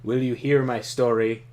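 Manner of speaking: loud, stately warlord reading; Trakov voice. Acted. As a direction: give it weight like a villain's speech.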